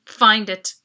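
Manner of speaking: blunt, loud brush-off; unhelpful. A narrator's reading. Bored desk clerk brushing off the hurried travellers, loud and unhelpful. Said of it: "with a not-so-helpful".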